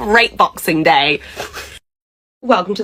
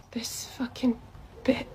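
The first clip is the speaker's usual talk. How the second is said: whispered aside; 3 semitones higher, 18 dB quieter than usual. Acted. Worker cursing the complaining customer under her breath, whispered.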